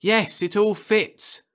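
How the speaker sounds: the pieces click into place; excited proclamation. Acted. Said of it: "Potshelter proclaimed excitedly".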